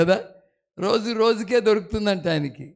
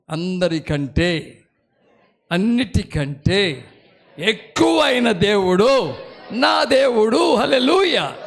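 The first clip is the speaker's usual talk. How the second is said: building declaration; 5 dB louder, much slower than usual. Shown as a demonstration. Preacher modeling a confession, building to a shout. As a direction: build slowly from a chuckle to a shout. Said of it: "మీకు మీరు చెప్పుకోవాలి".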